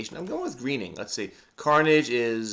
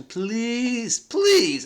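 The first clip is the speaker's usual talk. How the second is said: scoffing please; 8 semitones higher than usual. Real, not acted. Scoffing at Uncle Junk.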